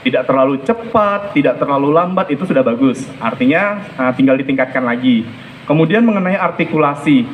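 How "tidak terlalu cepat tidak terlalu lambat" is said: normal talking voice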